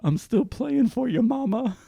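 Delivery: high, whimpering mock sob voice; mock crying son. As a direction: imitate him, teary and high. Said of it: "under his breath".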